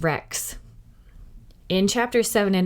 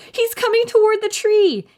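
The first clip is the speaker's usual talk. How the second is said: put-on high, louder frantic girl's scream; Annie screaming; 14 semitones higher, 6 dB louder than usual. A narrator's reading. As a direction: shriek at him to hurry. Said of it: "Annie cried".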